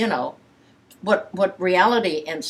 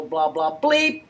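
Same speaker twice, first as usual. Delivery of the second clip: mock bleep; slower than usual. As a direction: imitate the censor's bleep. Mimicking a bleep censor.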